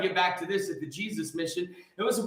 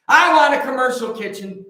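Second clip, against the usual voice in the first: louder, pushing the word kitchen hard; louder repeat of I want a commercial kitchen; louder insistence; 3 semitones higher, 8 dB louder than usual. Real, not acted. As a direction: hammer the wish home, louder. Repeats wish, second time louder.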